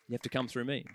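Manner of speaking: soft restatement; quiet echo. Acted. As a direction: let it land gently after the shout. Quiet echo after climax.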